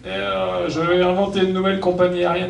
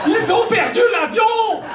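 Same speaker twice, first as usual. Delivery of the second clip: much higher, incredulous cry; shocked high exclamation; 13 semitones higher, slower than usual. Acted. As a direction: squeal it in disbelief. Punchline repeated, higher.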